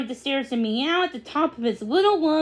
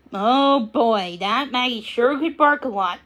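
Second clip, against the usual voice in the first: plain reading voice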